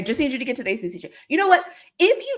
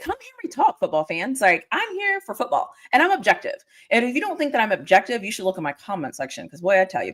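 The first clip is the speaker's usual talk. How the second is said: ordinary talk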